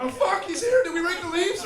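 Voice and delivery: hammed-up panicked squeal; mock panic. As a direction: imitate scared kids hearing dad arrive. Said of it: "if you didn't get scared".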